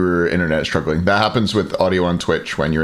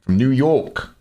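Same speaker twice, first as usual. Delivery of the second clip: attempted New York accent; 4 semitones higher, slower than usual. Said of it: "I can't do a New York accent".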